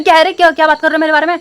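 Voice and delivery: angry confrontational shout, a bit held back; retold yell. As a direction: snap back at them, replaying the yell. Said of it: "मैंने सीधे चिल्ला गई".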